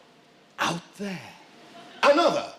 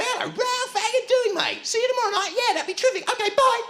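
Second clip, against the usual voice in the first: much higher, silly falsetto on the phone; chirpy falsetto phone chat; ridiculous high voice; 13 semitones higher, faster than usual. Pretend. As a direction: squeak it out like a daft caller. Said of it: "ridiculous high-pitched voice".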